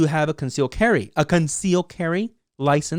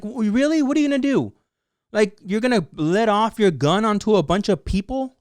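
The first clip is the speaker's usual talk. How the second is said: higher, incredulous retort; angry disbelief; 6 semitones higher than usual. Retold or imitated. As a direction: voice your own outrage at them, pitched up. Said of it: "when I get, like, really angry".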